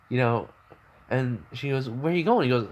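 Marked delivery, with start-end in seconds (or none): 1.9-2.4 put-on light, higher girlish voice. Toga greeting Midoriya in a raised girlish voice. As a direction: pitch up a bit for the girl.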